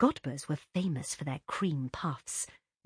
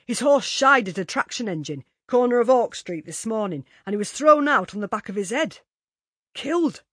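louder gossiping tradesman, relishing it; Godber's man telling; 6 semitones higher, 8 dB louder than usual. A narrator's reading. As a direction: tell it with relish. Delivery man telling story.

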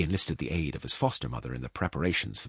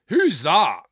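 very loud, high demand; giant's voice; 13 semitones higher, 11 dB louder than usual. A narrator's reading. Giant asking about the cradle, loud and blunt. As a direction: bellow the question as a giant. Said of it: "asked the giant".

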